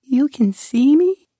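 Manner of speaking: high, timid monster voice; a shy little creature asking, high. Acted. Rumpel asks the child, high shy creature voice. Rumpel amazed he's seen.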